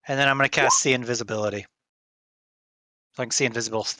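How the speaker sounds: normal talking voice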